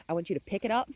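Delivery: ordinary delivery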